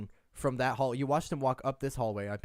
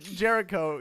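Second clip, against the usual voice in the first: ordinary delivery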